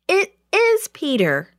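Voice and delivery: high-pitched tearful insistence. A narrator's reading. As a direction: plead it as if near tears. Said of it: "in a tearful voice".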